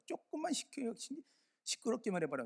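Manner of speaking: sudden high, hammed-up threat; mock threat. Neighbor threatening the church, mock angry threat. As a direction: ape the neighbor's shrill warning. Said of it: "막 욕을 하더니".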